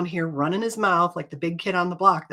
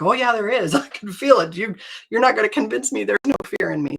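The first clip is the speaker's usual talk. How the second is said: raised, fast, laughing denial; laughing protest; 5 semitones higher, 4 dB louder than usual. Retold or imitated. To Ken, laughing and insisting she is afraid. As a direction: laugh while insisting you're scared.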